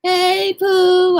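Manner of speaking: high, loud cooing greeting; greeting back. Retold or imitated. Answering the streamer's greeting in kind, high and sweet. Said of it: "So ever since I'm like".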